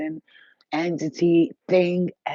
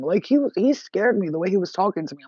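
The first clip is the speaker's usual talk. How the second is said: usual speaking voice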